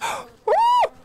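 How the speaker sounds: sudden high, loud gasp; startled yelp. Real, not acted. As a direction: jump at the bee sting. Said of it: "What the hell?".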